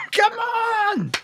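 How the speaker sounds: a very high come on; excited shout. Real, not acted. Urging team after equaliser.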